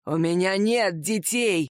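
low, slow arrogant declaration; haughty. A narrator's reading. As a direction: state it with cold certainty. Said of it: "высокомерно и абсолютно убеждённо заявил".

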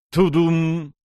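resonant two-beat heart thump; heartbeat imitation. A narrator's reading. Narrator sounding the severed heart's beat, a drawn-out thump. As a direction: voice a heartbeat, holding the second beat.

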